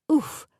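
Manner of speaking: high, loud grunt of impact; knocked-back "oof"; winded oof. Acted. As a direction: let out an oof as if hit in the gut. Dog rams into her gut.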